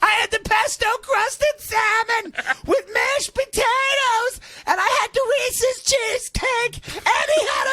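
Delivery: high squeaky confession to investigators; mock wife confessing. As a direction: spill every dish she ate.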